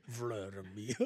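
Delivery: low, slow mock Russian; Russian accent. Joining in the accent game, slow Russian accent. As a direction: draw the name out in Russian. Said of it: "can someone do Russian?".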